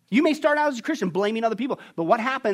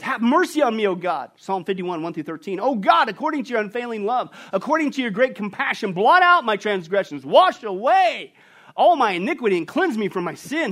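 scripture reading; 2 semitones higher, 5 dB louder than usual. Acted. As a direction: plead it as David's prayer. Reading David's psalm.

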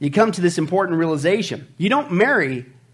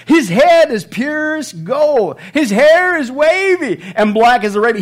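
loud, high, slow declamation; over-the-top lovestruck recitation; impassioned scripture reading; 8 semitones higher, 8 dB louder, slower than usual. Acted. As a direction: proclaim the love poem with huge drawn-out emphasis. Acts out the woman's love poem.

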